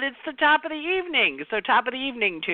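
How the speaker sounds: playful lift. Real, not acted. Playful twist on Irish greeting.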